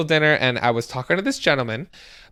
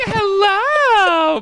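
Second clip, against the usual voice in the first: very high, louder, sing-song hello; voice up three octaves; 5 dB louder than usual. Retold or imitated. Guest strolling in to unsettle the family, very high squealed hello. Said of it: "My voice went up, like, three octaves".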